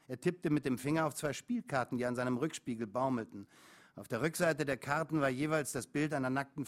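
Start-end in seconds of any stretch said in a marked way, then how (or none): none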